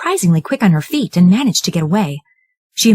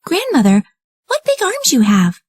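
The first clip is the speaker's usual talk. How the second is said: put-on high wondering girl; puzzled young girl; girl marvelling at grandmother's arms; high little girl voice; 11 semitones higher than usual. Red questions the disguised wolf.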